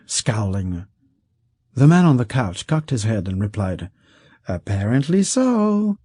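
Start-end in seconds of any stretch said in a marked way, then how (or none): 4.5-6.0 high, slow, dry reply; dry rich man. Acted. Rich man answering Kesk, slow and high. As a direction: let it drip with amusement.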